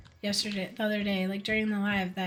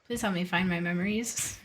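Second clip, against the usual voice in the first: ordinary delivery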